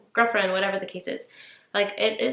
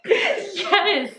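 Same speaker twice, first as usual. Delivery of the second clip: laughing; 12 semitones higher, 4 dB louder than usual. Real, not acted. Laughing over her missed moment.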